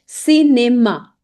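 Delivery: "Cinema" said slowly si-ne-MA; French pronunciation demo. Shown as a demonstration. Teacher models the CI word, slow si-ne-ma. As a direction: stretch "Cinema" into three syllables starting on S. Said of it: "C I gives a sound of C".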